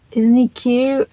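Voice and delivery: normal talking voice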